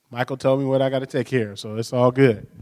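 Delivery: ordinary talk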